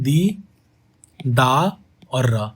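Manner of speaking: दी, दा और र counted out slowly, one syllable at a time. Shown as a demonstration. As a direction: split दीदार into दी, दा, र and say each slowly. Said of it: "अभी मात्रा की count करते हैं".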